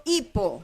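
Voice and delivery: "hipo" con fuerza en la "hi"; acento en la primera. Shown as a demonstration. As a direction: carga la primera sílaba de "hipo". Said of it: "la fuerza va a ir en la primera sílaba". (acento llano en "hipo", golpe inicial en "hi")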